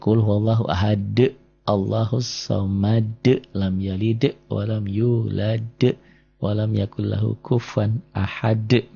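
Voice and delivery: slow chant, bouncing every final d as in "ahad"; qalqalah recitation. Shown as a demonstration. Teacher reciting the verse as example, slow bounced qalqalah. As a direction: add a short "e" echo after the d of "ahad", "samad", "yulad". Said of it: "yang merah-merah itu ada e-e-nya".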